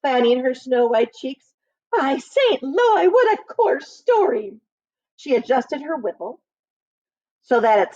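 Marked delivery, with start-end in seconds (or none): 1.9-4.6 slow, high, scandalised prim voice; prim prioress. A narrator's reading. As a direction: drag out the shock like a prude.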